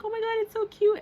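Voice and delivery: high, loud squeal of delight; mock gushing fan squeal; gushing over cuteness. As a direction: coo at it like a cute pet. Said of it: "you're like".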